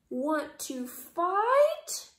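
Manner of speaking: high, very slow taunt; sing-song slow challenge; grouchy ladybug. A narrator's reading. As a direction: drag out the dare.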